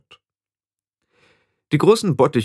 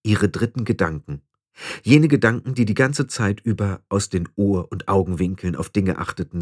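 ordinary delivery, nothing marked

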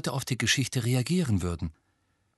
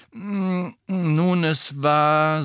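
awkward hesitation; 7 semitones higher, 6 dB louder, much slower than usual. Acted. Harry druckst verlegen herum.

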